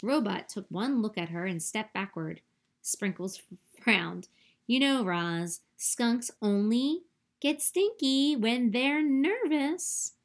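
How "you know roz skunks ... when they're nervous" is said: slow, higher and louder sheepish skunk; a skunk gently explaining herself; coy skunk voice. A narrator's reading. Skunk explains her smell.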